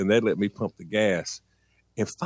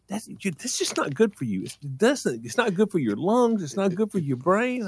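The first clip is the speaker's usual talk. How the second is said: high, lecturing mother; mother lays out why it's bad, pitched high; mom's voice; 9 semitones higher than usual. Mom lecturing her son about gas fumes, high.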